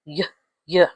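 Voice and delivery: "y, y" as two short yuh syllables; Y sound. Shown as a demonstration. Teacher showing the Y sound, Y sound. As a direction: sound out "y" alone, separately. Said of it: "Y sound is".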